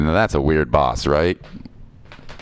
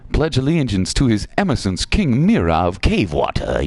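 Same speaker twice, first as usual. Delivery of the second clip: higher-pitched herald's proclamation; pompous guard; 5 semitones higher than usual. Acted. Voicing frog-riding guard's demand.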